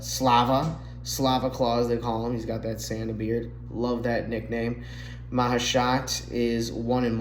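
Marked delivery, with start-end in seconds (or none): none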